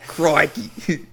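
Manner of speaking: high, loud Australian Crikey; loud, high mock Australian exclamation; mock Aussie crikey. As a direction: belt Crikey back in a hammed-up Australian voice. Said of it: "funny dialect".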